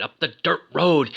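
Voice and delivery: usual speaking voice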